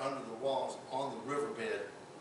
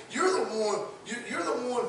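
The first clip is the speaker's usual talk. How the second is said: a hint of a prophet scolding the king, loud and higher; lightly voiced warning to Belshazzar; stern Daniel rebuke; 6 semitones higher, 5 dB louder than usual. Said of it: "I can see Daniel saying".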